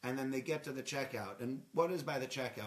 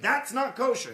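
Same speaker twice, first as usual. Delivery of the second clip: loud, high-pitched mock yell; yelling at his kid; 9 semitones higher, 10 dB louder than usual. Man in a supermarket telling off his kid, yelling. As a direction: holler it like a parent scolding a child. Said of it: "yelling at his kid".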